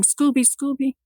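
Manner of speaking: high-pitched retold call without volume; mock yell. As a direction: re-enact calling for a lost dog, restrained. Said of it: "And I'm yelling".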